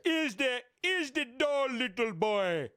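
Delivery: high-pitched, badly faked Bahamian accent; hammed-up mock Bahamian accent. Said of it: "really bad Bohemian accent".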